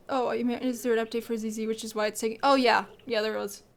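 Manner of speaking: normal talking voice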